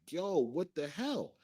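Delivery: taken aback, imitating own reaction; retold disbelief. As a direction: stretch it out, appalled at what you see. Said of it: "I'm looking at her like".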